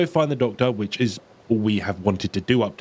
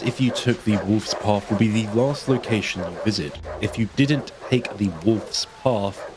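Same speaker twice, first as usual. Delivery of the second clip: ordinary talk, nothing marked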